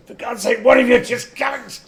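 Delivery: the Doctor losing patience, shouting; loud exasperated cry. Acted. Doctor yelling at squabbling companions.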